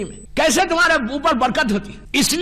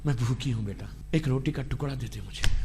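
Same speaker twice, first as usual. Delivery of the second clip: much softer, pleading hungry mother; begging mother; 11 dB quieter, faster than usual. Acted. Dead mother pleading with her son for bread, much softer and pleading. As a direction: beg for bread like a frail mother at the door. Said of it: "اگر ماں بولے کہ".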